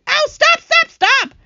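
high, loud pleading yelps; pained cries. A narrator's reading. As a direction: beg loudly for the pelting to stop. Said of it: "he cried".

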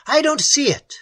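high-pitched, urgent call; agitated shout. A narrator's reading. Algernon unable to find the creature, shouting. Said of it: "he shouted".

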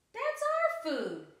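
very high, slow, loud squeal of protest. A narrator's reading. Dinosaur protests stolen food.

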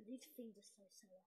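hushed whisper. Real, not acted. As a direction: whisper through the sourness. Sour candy reaction, whispered.

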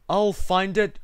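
loud, resolute elephant; determined cry. A narrator's reading. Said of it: "cried Horton".

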